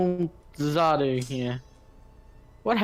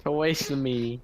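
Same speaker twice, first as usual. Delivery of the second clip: usual speaking voice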